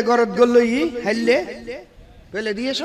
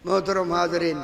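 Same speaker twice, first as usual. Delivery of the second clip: normal talking voice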